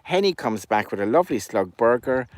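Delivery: usual speaking voice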